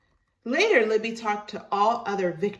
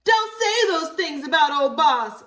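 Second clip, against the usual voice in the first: loud, high call after someone; 9 semitones higher, 9 dB louder than usual. Acted. Libby calling after Virginia to defend old Boss, loud and high. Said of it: "Libby called after her".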